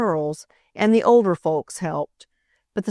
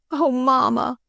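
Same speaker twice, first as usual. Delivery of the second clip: sobbing; 6 semitones higher than usual. Acted. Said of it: "she sobbed".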